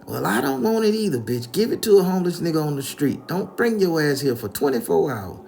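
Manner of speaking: high, loud acted tirade; loud, high-pitched scolding; furious grandma. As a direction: chew them out and banish them. Grandmother throws him out, acted.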